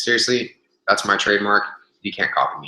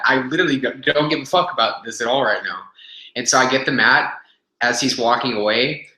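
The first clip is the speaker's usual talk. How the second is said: ordinary talk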